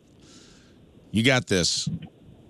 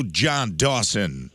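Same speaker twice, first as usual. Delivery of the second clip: slow announce; much slower than usual. Acted. Host reads quiz name dramatically.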